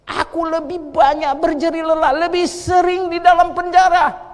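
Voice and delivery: high, loud recitation of Paul; loud preaching. Real, not acted. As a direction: proclaim Paul's words with force. Quoting Paul loudly.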